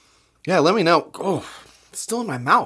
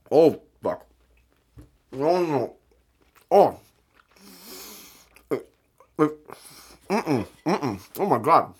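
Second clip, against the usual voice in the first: disgusted reaction; 3 semitones higher than usual. Real, not acted. Reacting to a harsh mustard taste.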